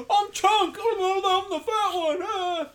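put-on high, loud crying kid voice; Chunk impression. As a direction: wail it like a blubbering fat kid. Imitating Chunk from Goonies.

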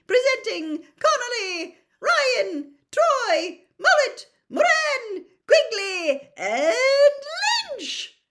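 high, loud booming announcer; announcer yell. A narrator's reading. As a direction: bellow to the whole stadium. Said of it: "yelled Bagman".

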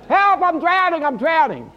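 hammed-up drowning shout, high and loud; yelled cry for help. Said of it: "man yells out".

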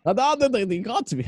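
high-pitched attempt at Australian; bad Australian accent. Host mimicking the crocodile hunter, bad Australian accent. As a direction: try an Australian accent and botch it. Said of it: "I can't do an Australian accent".

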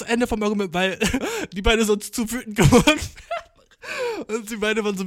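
lauter, durch Lachen gesprochen; lachend. Real, not acted. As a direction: lacht beim Erzählen mit. Lacht über den Streit. (er lacht über den Sauna-Streit, lauter und lachend)

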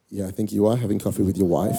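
imitating her cool certainty; calm, composed reply. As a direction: drop down low and reply with quiet assurance. Her calm answer after his panic.

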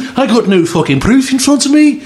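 hammed-up Brummy outburst, swearing; mock Brummy accent. Impersonating the fat actor's Brummy accent in Chernobyl. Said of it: "his Brummy accent or whatever".

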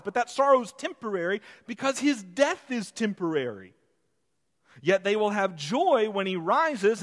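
high, loud preaching build; fervent preaching. Acted. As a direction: preach it out to the congregation. Pastor preaching at full force.

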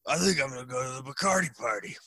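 slurred, boozy declaration; words run together by too many drinks; drunken slur. Retold or imitated. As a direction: play yourself stumbling drunk. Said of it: "out of my mind, like, you know, stumbling around".

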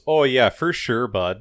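hammed-up Canadian accent; friendly mock-Canadian lilt. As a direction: imitate a very Canadian speaker. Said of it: "in a very Canadian accent just goes".

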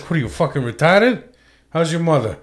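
imitated Boston accent, comic. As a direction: do a rude Bostonian with a friendly follow-up. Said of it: "what's funny about their accent".

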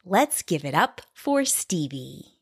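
usual speaking voice

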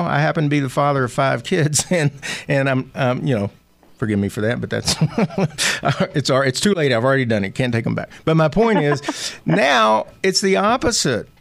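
ordinary delivery

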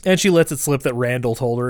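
ordinary talk, nothing marked

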